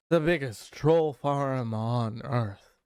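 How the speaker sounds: slow, drawn-out mock billionaire voice; put-on Elon voice. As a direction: read it slowly in a put-on smug voice. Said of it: "oh, I had to do my voice".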